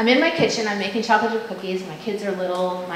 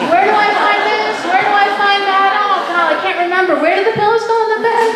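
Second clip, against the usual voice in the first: much higher and louder, flustered rapid questions; frazzled mom; 10 semitones higher, 6 dB louder than usual. Retold or imitated. Mom searching the house asks frantic questions, high and loud. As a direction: act out a scatterbrained, frantic mom. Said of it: "Because I ask all these questions".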